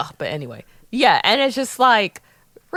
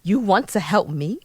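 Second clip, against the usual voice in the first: low, theatrical put-on British accent; 5 semitones lower, 3 dB quieter than usual. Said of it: "Let me speak in an accent".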